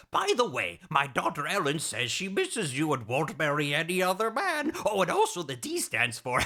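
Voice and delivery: high-pitched eccentric scientist; hammed-up batty doctor; Doctor Madnar. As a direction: chatter on in a swooping, high cartoon voice. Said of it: "He says".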